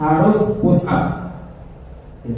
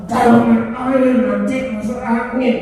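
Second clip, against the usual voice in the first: higher, slow, harsh warning; changed, threatening intonation; 5 semitones higher, slower than usual. Shown as a demonstration. Teacher showing how intonation alone changes a warning, threatening. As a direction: turn the advice into a scary scolding. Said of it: "Kalau intonasi kita rubah".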